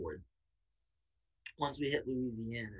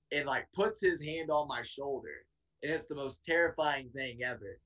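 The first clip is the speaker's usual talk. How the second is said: ordinary talk, nothing marked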